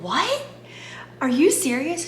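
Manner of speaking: high, stunned squeak of surprise; shocked disbelief. Retold or imitated. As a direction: gasp it out in disbelief. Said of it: "I was completely in shock".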